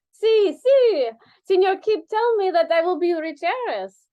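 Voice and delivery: high, loud hammed-up Spanish accent. Dancer brags about being the rich heiress, hammy accent. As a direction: gush it in a big Latin voice. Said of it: "I am not good at this, doing Spanish".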